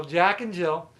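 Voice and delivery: ordinary talk, nothing marked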